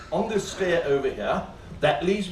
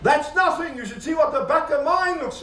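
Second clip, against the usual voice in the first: shouted retort; 10 semitones higher than usual. Retold or imitated. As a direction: yell it over the cabin. Passenger shouts out a reply.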